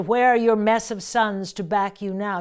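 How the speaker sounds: loud, scornful line of verse; declaimed verse. Shown as a demonstration. Margaret scorning captured York, loud. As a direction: taunt him with the question. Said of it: "Then there".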